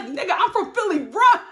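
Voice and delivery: Kelly dropping the fake accent, shouting; urgent shout. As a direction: bark the order to flee. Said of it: "She's like".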